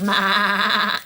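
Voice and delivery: held, monotone bleating "ma"; goat bleat. Pretend. Finding Gordy Goat on Music Road, goat bleat. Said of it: "each time you find Gordy Goat".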